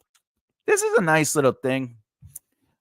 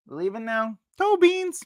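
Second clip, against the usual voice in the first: high pet coo; 11 semitones higher than usual. Real, not acted. Cooing at the leaving pet.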